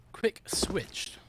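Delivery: soft, separated "Quick switched"; careful re-saying. Shown as a demonstration. Caster fixing a garbled word, careful re-saying. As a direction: say "Quick switched" again, both words distinct. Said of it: "half a word".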